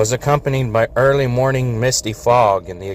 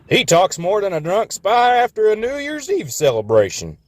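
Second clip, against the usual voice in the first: much higher hammed-up Texan quip; Texan accent joke; 10 semitones higher than usual. A narrator's reading. Texas joking about Florida, high hammed-up Texan. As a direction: crack a cowboy joke. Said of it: "that ridiculous accent".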